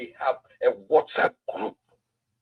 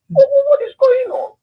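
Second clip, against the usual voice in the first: much higher and louder, indignant; outraged shout; 9 dB louder than usual. Real, not acted. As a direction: demand an answer, exasperated. Outraged at the scene described.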